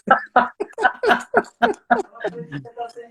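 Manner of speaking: long high laugh falling away; laughing out loud late at night; high burst of laughter. Real, not acted. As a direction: break into a big high laugh. Host laughs at shouting remark.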